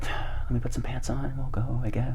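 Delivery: hushed, whispery giving-in; mumble-soft mock resignation; resigned half-whisper. Sleepy guest agreeing to church, resigned whisper.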